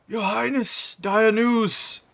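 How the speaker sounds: high-pitched, alarmed herald; urgent older priest. Acted. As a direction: burst in crying out to the king. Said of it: "bursting from a door is an older man".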